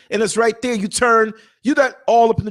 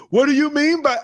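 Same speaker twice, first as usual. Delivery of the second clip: passenger voice; 6 semitones higher than usual. Retold or imitated. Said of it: "this is how I said it without saying it".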